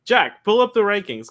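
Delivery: loud call across the room; shouting to Jack. Real, not acted. Calling out to producer.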